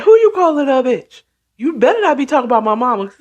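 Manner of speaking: high, quick acted-out retort; indignant challenge. Daughter warning someone off her mama, mock-indignant. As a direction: act out someone taking offense, pitch up.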